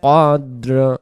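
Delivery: slow, melodic Quran recitation. Acted. Teacher recites Surah Qadr for the students, melodic. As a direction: recite each phrase slowly in tajweed.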